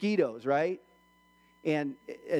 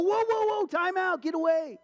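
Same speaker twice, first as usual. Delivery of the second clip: high-pitched mock panic; acted freak-out; 11 semitones higher than usual. Startled patient refusing a needle, freaking out. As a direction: fend it off in exaggerated alarm. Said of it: "you're freaking out".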